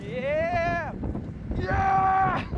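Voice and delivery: thrilled yells on the ride; on a roller coaster. Real, not acted. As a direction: shout it out mid-ride. Riding the coaster.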